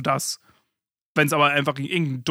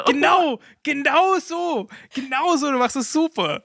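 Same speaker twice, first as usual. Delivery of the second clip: much higher, sing-song praising; mock praise; 11 semitones higher, 4 dB louder than usual. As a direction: patronize her like a proud parent. Praises co-host like a child.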